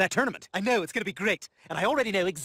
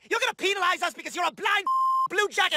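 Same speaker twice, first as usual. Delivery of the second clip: furious yelling; 11 semitones higher than usual. Acted. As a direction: scream at the umpire in a rage. Furious tennis player rants at umpire.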